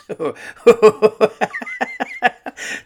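high real giggling fit; helpless laughter. Real, not acted. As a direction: burst out laughing at what you read. Cracks up at the email.

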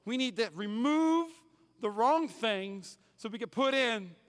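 preacher hammering the key line; slow, emphatic preaching. Acted. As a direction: drive the point home slowly. Preaching the main point.

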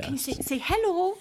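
high singsong coaxing; baby talk. Acted. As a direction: coo it to a toddler. Aunt coaxes baby to speak.

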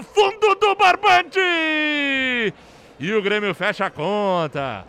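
high, loud celebratory recap; excited goal recap. Real, not acted. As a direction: relive the goal, shouting. Goal replay narration. (narração empolgada do gol)

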